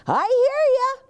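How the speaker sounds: high, anxious little truck voice; Gus voice. A narrator's reading. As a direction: squeak it out nervously as little Gus. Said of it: "says Gus".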